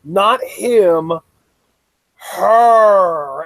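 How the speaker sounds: mock panic, building to a loud cry; imitating frantic correction. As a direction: rattle off the denials, then punch the last word loudly. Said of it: "Sexy's like".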